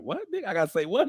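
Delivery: New York wife's mock outrage; wife's incredulous reply. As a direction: imitate the wife balking. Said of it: "she from New York".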